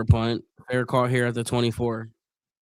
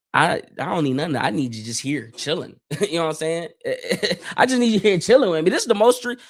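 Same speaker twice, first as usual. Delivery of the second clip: ordinary talk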